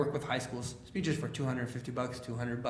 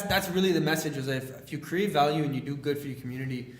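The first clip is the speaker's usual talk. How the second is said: ordinary talk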